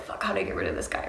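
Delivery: breathy whisper under the breath, quick; whispered thought. Retold or imitated. Thinking to herself about ditching the guy, fast whisper. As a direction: hiss it to yourself like a secret panic. Private thought on meeting him.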